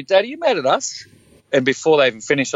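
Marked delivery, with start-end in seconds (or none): 0.0-1.1 put-on high, childlike question; imitating his daughter. Daughters checking if dad is mad, high childlike voice. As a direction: put on a little girl's voice, checking gently. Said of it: "they'll say to me".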